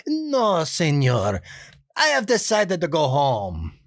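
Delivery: put-on high, slow pleading Spanish-accented plea; a nice guy begging off in a thick Spanish accent; hint of dubbed Spanish accent; Benito voice. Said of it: "more senor for the accent there".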